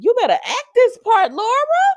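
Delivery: high, loud whoop of praise; hyped cheering. Real, not acted. Viewer cheering Laura's acting, loud excited shout. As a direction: cheer the performer on at full pitch.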